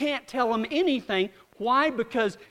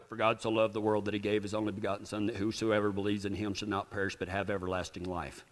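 quick, low recitation from memory; rattled off; 14 semitones lower, 7 dB quieter, faster than usual. Retold or imitated. Preacher quoting the verse he told them to recite.

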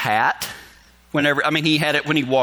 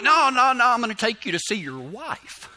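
higher-pitched, hurried-in reassurance; retold coaxing, pitched up; placating; 8 semitones higher than usual. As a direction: talk down a stubborn patient. Coaxing the balking boss, retold.

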